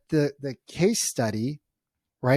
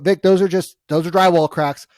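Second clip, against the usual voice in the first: quoting the husband; 4 semitones higher, 7 dB louder than usual. Retold or imitated. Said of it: "He's like".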